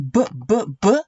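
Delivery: "b, b" as short separate "buh" sounds; letter B sound. Shown as a demonstration. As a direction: pop out "b" as a quick "buh". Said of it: "the letter B sound".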